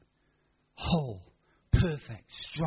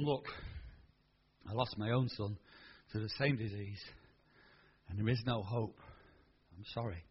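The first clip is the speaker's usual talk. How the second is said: lower, much softer sombre voice; grave doctor; 8 semitones lower, 11 dB quieter than usual. Retold or imitated. Doctor telling the mother there's no hope, soft and low. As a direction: break the bad news gently.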